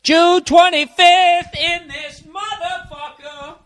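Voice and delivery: high, slow, triumphant sing-song; triumphant announcement. Real, not acted. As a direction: announce the date like a victory.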